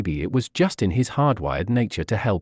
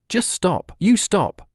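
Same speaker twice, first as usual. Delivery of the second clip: sharp raised retort, pitched well up; angry; 7 semitones higher, slower than usual. A narrator's reading. Courage firing back at the accusing stray, angry. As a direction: snap it back hard. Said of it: "Courage fired back".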